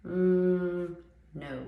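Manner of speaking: loud, high drawn-out mm before a flat no; rejecting a date who showed up with baggage; emphatic no. Retold or imitated. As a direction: shut them down hard. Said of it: "I was like".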